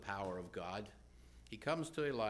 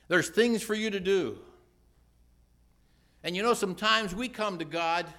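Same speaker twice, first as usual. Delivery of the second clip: loud, high, fervent sermon build; preaching at full force; 9 semitones higher, 9 dB louder than usual. Acted. Preacher urging the church to be honest with God. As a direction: rise and push each line harder.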